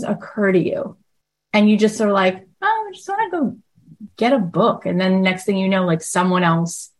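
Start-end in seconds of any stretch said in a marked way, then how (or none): 2.6-4.9 light, floaty thought voice, much higher; a breezy high daydream; airy inner voice. Retold or imitated. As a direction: play the whim as a sudden light idea. Acting out a passing inner thought.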